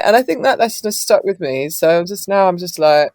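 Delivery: ordinary talk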